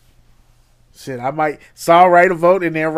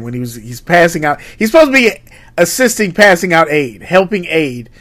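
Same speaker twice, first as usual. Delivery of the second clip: ordinary talk, nothing marked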